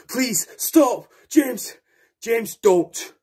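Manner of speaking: high, loud begging to stop; desperate pleading. Acted. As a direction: beg him to let go. Victim begging him to stop.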